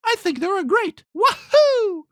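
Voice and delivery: very high cartoon plumber voice, ending in a loud wahoo; squeaky Mario voice. Podcaster mocks a guy voicing Mario, very high cartoon voice. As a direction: pitch way up like a tiny cartoon plumber.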